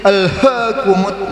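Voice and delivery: slow "Alhaakumut" with too much breath on "h"; overdone h. Shown as a demonstration. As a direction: push too much air through the "h" in "Alhaakumut". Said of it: "ini terlalu over". ("Alhaakumut" dengan h terlalu berlebihan)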